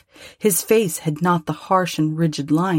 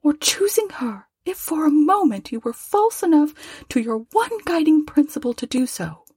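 put-on higher, gentle voice of a grieving young woman; young girl, sorrowful; 11 semitones higher than usual. A narrator's reading. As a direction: lift into a tender girl's voice, close to tears.

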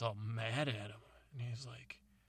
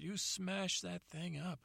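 higher, hurt father complaining; dad voice; 7 semitones higher than usual. Retold or imitated. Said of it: "and he said".

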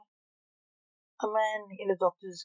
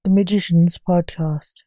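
dramatic intro; 12 dB louder than usual. Acted. Podcast intro.

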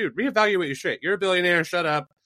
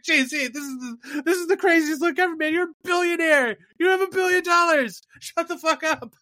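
much higher, louder fake crying wail; mock sobbing plea; 14 semitones higher, 4 dB louder than usual. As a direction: blubber it like an overwrought fan begging. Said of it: "but it's like".

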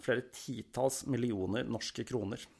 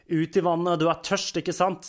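loud, higher, mocking taunt; a louder jeer meant to torment; cruel taunt; 6 semitones higher, 6 dB louder than usual. A narrator's reading. Countess driving the girl into the icy water, loud taunting. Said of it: "sa grevinnen".

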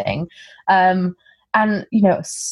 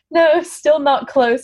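high, slow mock-patient no; drawn-out denial; 9 semitones higher, much slower than usual. Retelling wrong guesses about her origin, drawn-out denial. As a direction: let them down slowly, pitched up high.

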